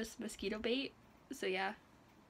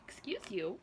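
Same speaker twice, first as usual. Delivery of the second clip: usual speaking voice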